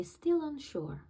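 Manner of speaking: usual speaking voice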